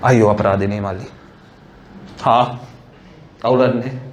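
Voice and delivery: a lightly voiced phone canvasser; a hint of a pushy campaign caller. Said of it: "starts with something like".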